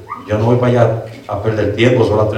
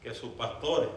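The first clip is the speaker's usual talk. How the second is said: much softer, intimate sermon; hushed preaching; 17 dB quieter than usual. Acted. Preacher drops voice.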